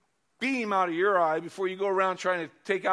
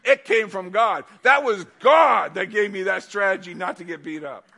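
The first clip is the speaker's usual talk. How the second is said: fervent shout; 2 semitones higher, 9 dB louder than usual. Real, not acted. Preacher's fervent proclamation.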